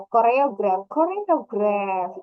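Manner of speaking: choreograph said slowly, syllable by syllable; word stress demo. Shown as a demonstration. Teacher demonstrating stress on choreograph, slow. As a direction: stretch out choreograph to show the stress. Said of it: "Di mana penekanannya".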